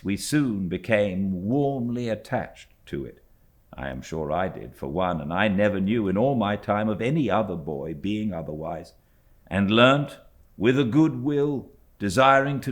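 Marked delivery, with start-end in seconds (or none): none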